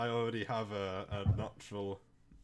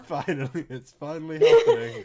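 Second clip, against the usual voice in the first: laughing; 6 semitones higher, 17 dB louder than usual. Real, not acted. Excited about finally starting.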